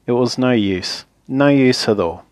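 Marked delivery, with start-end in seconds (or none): none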